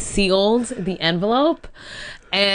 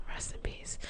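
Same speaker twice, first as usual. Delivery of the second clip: whisper; 19 dB quieter than usual. Acted. Mock mourning for a dead character.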